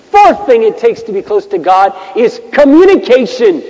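high, loud, drawn-out exhortation; ringing, emphatic sermon delivery; full-force preaching. Real, not acted. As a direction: preach it out to the whole room.

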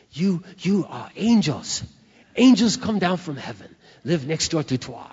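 put-on emphatic broken-English accent, halting at first; imitated broken English. Neighbour writing thanks to the wife, imitated broken English. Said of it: "in her broken English".

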